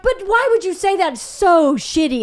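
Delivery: high-pitched mock complaint, stretching SO; retold hurt protest; wounded husband. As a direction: imitate an aggrieved whine.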